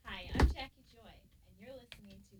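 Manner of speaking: speaking without dentures; words gummy with no teeth; toothless. Real, not acted. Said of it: "Let me take my teeth out".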